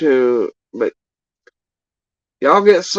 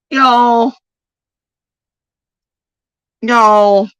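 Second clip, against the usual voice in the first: disbelief; 11 semitones higher, 5 dB louder than usual. Real, not acted. Disbelief at what was said.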